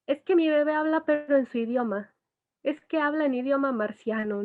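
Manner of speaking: a hint of a parent boasting about the baby; mild imitation of an amused mom; proud parent. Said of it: "porque es cuando dicen".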